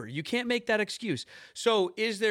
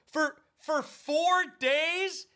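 incredulous; 10 semitones higher, much slower than usual. Real, not acted. Mocking excuse, disbelief.

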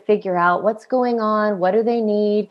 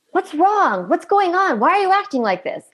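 much higher, sharp exasperated questioning; asked angrily; 10 semitones higher than usual. Retold or imitated. Parent confronting a misbehaving toddler, angry demanding questions. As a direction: demand an answer, fed up. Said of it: "instead of asking it angrily".

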